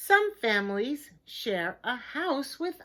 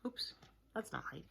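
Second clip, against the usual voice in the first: whisper; 13 dB quieter, faster than usual. Real, not acted. Reader catches own mistake.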